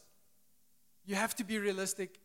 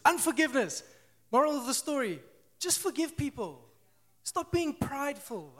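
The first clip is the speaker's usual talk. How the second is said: higher, louder exasperated rant; 6 semitones higher, 4 dB louder than usual. Real, not acted. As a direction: let it all out, fed up. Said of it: "rant over".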